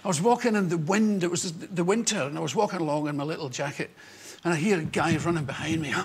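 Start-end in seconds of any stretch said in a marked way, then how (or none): none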